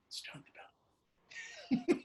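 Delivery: hushed, breathless whisper of a starstruck passerby; imitated whisper. Passersby whispering about the celebrity, mock hushed. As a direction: breathe the name like a secret. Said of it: "the one that gets me the most is the whisper".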